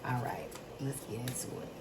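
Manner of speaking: a private hushed start before reading; whispered start. Real, not acted. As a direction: whisper it, unvoiced and close.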